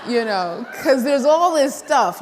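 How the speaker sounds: words bubbling with laughter; laughing. Real, not acted. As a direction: laugh through the line. Laughing at her co-creators.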